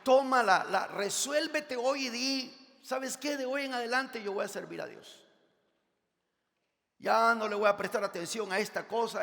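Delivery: rally the congregation with a loud challenge; full-force preaching. Acted. (predicación a todo pulmón)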